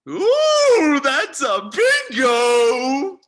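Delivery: high, loud, drawn-out squeal of delight; gleeful exclamation. Acted. Colonel delighted by his captors' confirmation, gleeful exclamation. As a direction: crow it slowly, stretching every word. Colonel exults at a correct guess.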